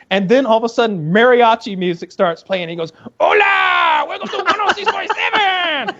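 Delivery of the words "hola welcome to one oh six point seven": very high, shouted mock Mexican radio host accent; hammed-up Mexican DJ accent yelling with glee; over-the-top fake Mexican accent, screaming. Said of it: "doing this super racist Mexican voice".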